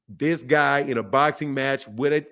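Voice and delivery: normal talking voice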